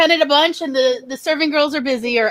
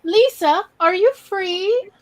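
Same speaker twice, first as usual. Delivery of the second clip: lilting, higher camp shop-floor call; camp department store call; 6 semitones higher, slower than usual. Retold or imitated. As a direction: sing out the names like a camp shopwalker. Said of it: "my one woman cosplay of Are You Being Served".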